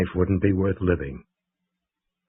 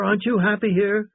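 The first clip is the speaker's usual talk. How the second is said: much higher, pleading ask; coaxing question; 12 semitones higher than usual. A narrator's reading. Crew coaxing Sugar about being happy, coaxing. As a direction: tease him gently into answering.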